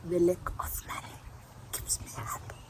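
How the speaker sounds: hushed, secretive whisper; conspiratorial joking whisper; whispered aside. Pretend. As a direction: whisper it like a confession. Confiding a joke aside.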